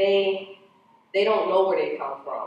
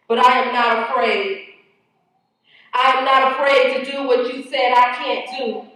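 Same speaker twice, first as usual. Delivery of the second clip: forceful, higher and louder poetry recital; spoken-word poem; 9 semitones higher, 10 dB louder than usual. Acted. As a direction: declaim it defiantly, driving line after line. Performs own spoken-word poem.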